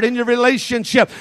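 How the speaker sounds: ordinary talk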